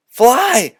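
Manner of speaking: high, loud urgent yell; cried out. Acted. Boys crying out to their dead brooms, loud and urgent. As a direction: order the brooms to rise. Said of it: "cried the boys".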